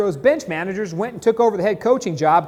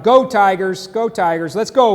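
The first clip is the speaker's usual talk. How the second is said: loud, proud team cheer; 6 dB louder than usual. Real, not acted. Radio host cheering on the LSU Tigers, loud and proud. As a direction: chant it like a fan in the stands.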